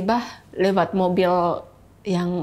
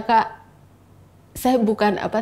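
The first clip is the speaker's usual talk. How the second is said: usual speaking voice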